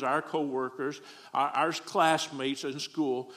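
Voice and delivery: ordinary delivery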